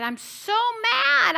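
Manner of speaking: high, drawn-out cry of frustration; mock mad. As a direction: stretch it out, exasperated. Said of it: "I'm mad".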